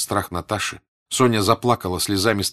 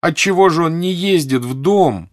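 higher, drawn-out puzzled questions; raised, searching questioning; insistent questioning; 7 semitones higher, 6 dB louder, slower than usual. A narrator's reading. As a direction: press for an answer, stretching the words. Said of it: "спрашивала Соня".

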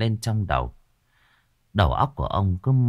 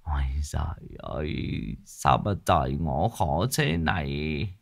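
whispered, much softer groaning; moaning lament; 4 semitones lower, 6 dB quieter than usual. Acted. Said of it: "Lão thì than than thở thở".

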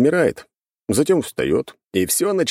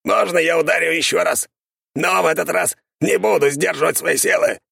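eager skeleton voice; 12 semitones higher than usual. A narrator's reading. Eager skeleton asks to strike.

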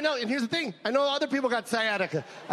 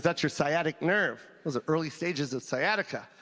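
calm doctor; 6 semitones lower, 3 dB quieter than usual. Retold or imitated. Doctor explaining sciatica to the comic, low and matter-of-fact. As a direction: explain it flatly like a tired physician. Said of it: "He goes".

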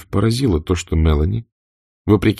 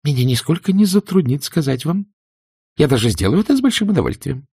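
higher, quick, biting reply; cold retort; 9 semitones higher than usual. A narrator's reading. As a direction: throw the challenge back. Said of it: "заявила Индия, сверкнув глазами".

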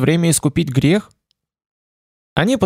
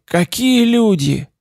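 higher, slower hoarse lament; hoarse pained cry; 8 semitones higher, slower than usual. A narrator's reading. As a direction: let the pain break upward. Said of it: "Голос его был хриплый, полный боли".

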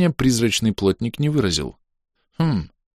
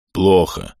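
muffled reply. Acted. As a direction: answer dully. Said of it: "глухо отозвался Иглий Брюхонос".

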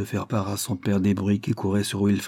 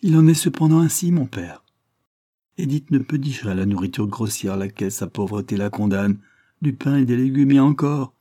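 pleading daughter; 2 semitones higher, 3 dB louder than usual. Acted. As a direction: push hard to move a stern father. Maria pleads for Edith.

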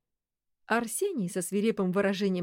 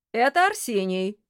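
loud shout; 4 semitones higher, 7 dB louder than usual. Acted. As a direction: call it out loud. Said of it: "крикнул Кудесников".

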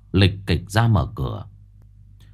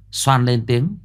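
ordinary delivery

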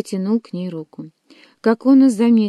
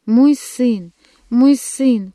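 higher, louder anguished call; mother's cry; 4 semitones higher, 6 dB louder, much slower than usual. A narrator's reading. Mother pleading for her only son, crying out. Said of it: "кричала мать".

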